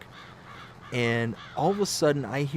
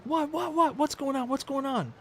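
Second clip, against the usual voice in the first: high, rapid-fire alarmed questions; high-pitched mock panic; panicked alarm; 12 semitones higher than usual. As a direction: rush outside demanding to know what happened. Rushes out ready to fight.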